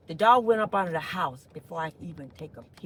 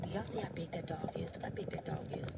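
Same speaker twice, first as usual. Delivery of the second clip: hushed, wary whisper; 3 semitones lower, 12 dB quieter than usual. Real, not acted. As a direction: whisper so the dog won't hear. Whispering about big dog.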